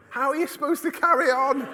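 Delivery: high, loud retold protest; hammed-up exasperated yell; exasperated outburst. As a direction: cry it out in comic despair.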